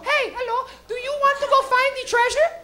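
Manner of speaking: high, chirpy cartoon girl; Dora voice. Mocking Dora the Explorer talking to the camera, high cartoon voice. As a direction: ask the viewer brightly like a kids' host.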